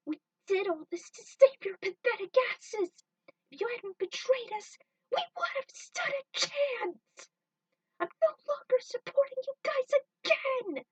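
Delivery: high, loud, tearful rage at a betrayer; furious yell. Acted. Trudy screaming at Jet over his betrayal, furious and tearful. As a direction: scream it through tears of rage.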